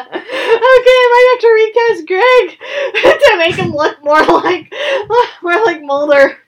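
laughing through the words; cracking up mid-sentence. Real, not acted. Laughing at own casting idea.

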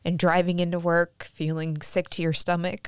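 ordinary talk

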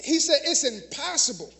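ordinary delivery

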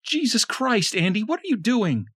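Tanya shouting at her bleeding husband. A narrator's reading. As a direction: yell in horror. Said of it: "A woman shouted".

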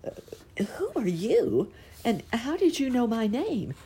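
breathless whisper, then rising high; a startled caterpillar finding its voice; whispering in surprise, high. A narrator's reading. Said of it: "so startled that he had trouble finding his voice".

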